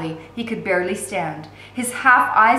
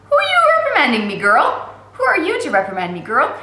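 much higher, louder, fast snarling retort; 7 semitones higher, 6 dB louder than usual. A narrator's reading. Jailer snarls at the girl who scolded him, fast snarl. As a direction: snap it back at her, contemptuous. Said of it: "he snarled".

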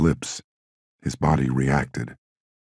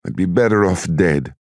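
higher-pitched, self-pitying lament; despairing mother; 5 semitones higher than usual. A narrator's reading. Tearful mother despairing before her son, self-pitying.

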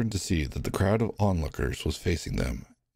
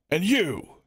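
much higher, angry accusing shout; furious warden; 11 semitones higher than usual. Acted. Warden condemning the prisoners, angry shout. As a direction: condemn them all.

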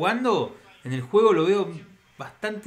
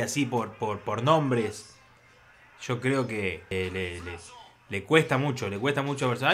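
ordinary talk

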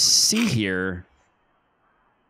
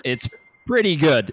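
echoed approval; 5 semitones higher, 5 dB louder than usual. Retold or imitated. Echoes the other host's verdict.

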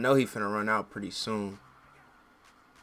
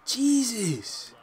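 exasperated exclamation; 12 semitones higher, 5 dB louder than usual. Real, not acted. After the outburst.

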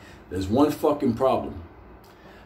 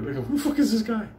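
annoyed family; 8 semitones higher, faster than usual. Retold or imitated. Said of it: "They're like".

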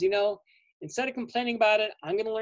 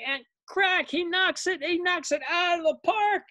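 higher, rising play-by-play thrill; higher excited sportscaster call; excited home run call; 11 semitones higher than usual. A narrator's reading. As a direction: call the hit like a thrilled announcer. Grand slam swing in story.

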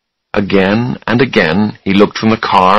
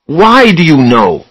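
higher, louder, slow angry question; angry demand; 8 semitones higher, 5 dB louder, slower than usual. Acted. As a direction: push for an answer, voice raised. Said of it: "Charlie said angrily".

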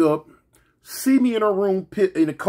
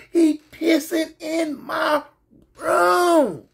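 angry yelling; 8 semitones higher, slower than usual. Retold or imitated. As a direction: shriek it like an outraged sister. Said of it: "she was like".

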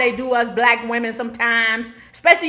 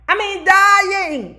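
slow, drawn out and louder on dying; stressed dying; 7 semitones higher, 6 dB louder, much slower than usual. Real, not acted. A wife telling the audience, slow stressed dying. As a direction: lean hard into dying, stretching it. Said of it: "I'm on the floor dying".

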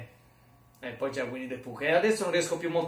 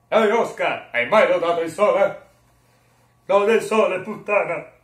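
very loud, higher character voice; Soler; 5 semitones higher, 11 dB louder than usual. Acted. Second try at Soler calling Oscar, loud character voice. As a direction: belt it out as a cartoon character. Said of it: "Poi c'è sta la voce di Soler".

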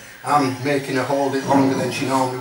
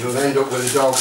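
normal talking voice

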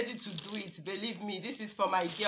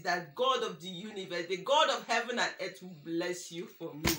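lower, softer prayer; soft prayer blessing; 4 semitones lower, 4 dB quieter than usual. Real, not acted. Vlogger thanking the gift-giver with a soft blessing. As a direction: bless her gently in prayer.

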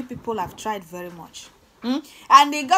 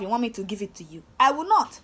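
ordinary delivery

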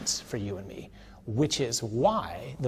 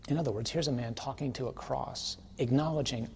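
ordinary talk, nothing marked

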